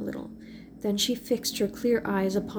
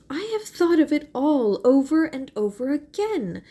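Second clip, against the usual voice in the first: persisting; 5 semitones higher, 7 dB louder than usual. A narrator's reading. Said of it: "persisted poor Mary".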